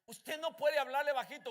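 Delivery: normal talking voice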